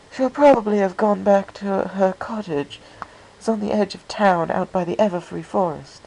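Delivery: Rarity explaining where Fluttershy lives, high and refined; refined pony lady. A narrator's reading. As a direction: voice an elegant, polite lady. Rarity voiced by narrator.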